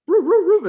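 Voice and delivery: high, loud yelping howls; dog howling. Retold or imitated. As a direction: howl like a dog just stung. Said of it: "their dog is like".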